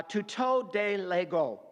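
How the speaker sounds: "tuto de lego" read slowly in careful Koine Greek sounds; Greek phrase read aloud. Shown as a demonstration. Preacher reading the Greek to his class, slow and careful. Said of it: "And a tuto is a demonstrative pronoun".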